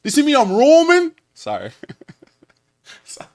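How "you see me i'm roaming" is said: mock Scouse, pitched up and loud at the end; hammed-up Scouse accent impression; S D Kid. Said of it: "that's my S D Kid i-, impression".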